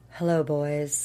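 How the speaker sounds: low, sultry greeting; sexy Michael voice. Retold or imitated. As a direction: purr it out seductively. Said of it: "In this totally different, like, sexy Michael voice".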